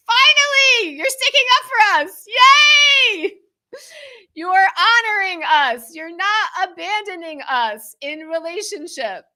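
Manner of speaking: put-on high, loud, squealing child's cheer; shrieking with glee as a happy child; inner child. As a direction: burst out like an overjoyed little kid. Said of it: "my inner child inside of me going".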